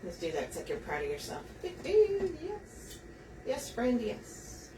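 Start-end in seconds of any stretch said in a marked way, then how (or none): 1.6-4.7 higher, soft cooing praise; pet talk. Acted. Praising the bow she just made, high soft baby talk. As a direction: coo at it like a proud puppy.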